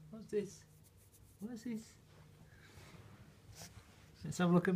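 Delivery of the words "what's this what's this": hushed high coo to a puppy; gentle coo, almost breathless; soft cooing. Real, not acted. Cooing at puppies.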